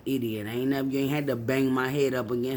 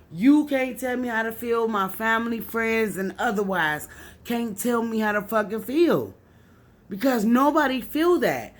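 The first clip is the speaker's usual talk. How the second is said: furious rant; 5 semitones higher than usual. Real, not acted. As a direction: shout down everyone who judges her grief. Real rant about her grief.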